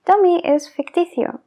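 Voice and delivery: ordinary delivery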